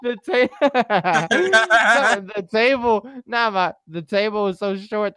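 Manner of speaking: high, loud laughter through the words; laughing. Real, not acted. Friend telling the waxing story, breaking into laughter. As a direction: laugh while trying to tell it. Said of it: "Wait, what?".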